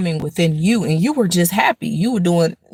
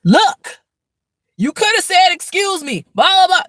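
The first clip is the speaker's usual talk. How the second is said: mock outburst; 11 semitones higher than usual. Telling off a cart-pusher in line, hammed-up outburst. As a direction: pitch it up and snap at them, half-acted. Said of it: "you just have an outburst".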